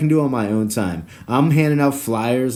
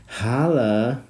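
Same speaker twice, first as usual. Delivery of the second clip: ordinary delivery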